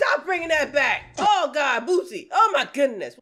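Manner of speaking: loud, high, disbelieving protest; exasperated outcry. Real, not acted. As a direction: cry out in mock horror at the screen. Reacting to a filter video.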